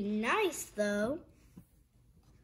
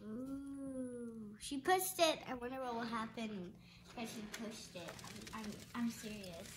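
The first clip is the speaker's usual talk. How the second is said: nervous murmur; 6 semitones lower, 10 dB quieter than usual. Acted. Larry nervous aside.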